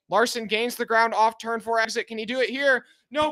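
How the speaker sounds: ordinary talk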